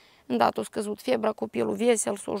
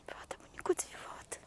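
soft, breathless whisper to her granddaughter; barely voiced, grieving whisper; 15 dB quieter than usual. Real, not acted. Grandmother speaking to lost granddaughter. (șoaptă îndurerată)

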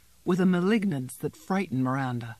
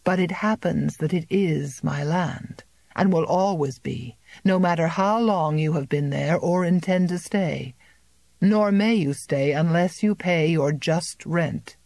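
ordinary delivery, nothing marked

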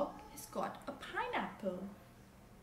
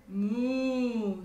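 loud, long storybook moo; cow's moo; 10 dB louder than usual. A narrator's reading. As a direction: moo out like a cow. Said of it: "said the cow".

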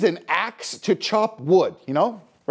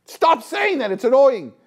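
annoyed outburst, higher pitched; exasperated telling-off; 4 semitones higher, 3 dB louder than usual. Retold or imitated. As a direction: cut in with fed-up irritation. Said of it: "says, like".

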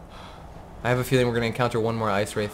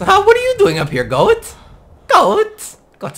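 very high, louder surprised calls; startled to see a goat there, pitch jumping up; high incredulous calling to the goat; 7 dB louder than usual. Real, not acted.

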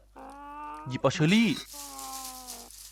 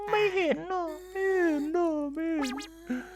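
high squeaky character voice; 14 semitones higher, 5 dB quieter, slower than usual. Pretend. Voicing game character's reply.

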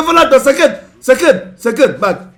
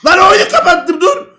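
annoyed shout; 7 semitones higher, 5 dB louder than usual. Real, not acted. Teammate flicked him.

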